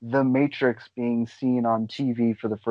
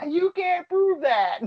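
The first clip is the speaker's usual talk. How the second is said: high, slow, laughing game-show announcer voice; booming host voice, stretched out and giggling; slower than usual. Retold or imitated. Mocking a friend's quip like a game show host. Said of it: "I want that to be a game show".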